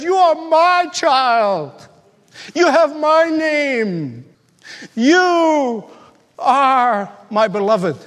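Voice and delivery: put-on slow, high and tender, like a father holding his child; tender voice of God. Preacher voicing God embracing his child, slow and tender. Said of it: "he says".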